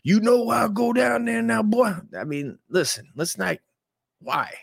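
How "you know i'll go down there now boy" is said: higher, louder mock tough voice; imagined tough guy. Imagining Tony Baker answering as a mock tough guy.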